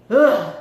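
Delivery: loud groan of disgust; loud disgusted ugh. Retold or imitated. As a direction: groan at the bad news. He's now in her house.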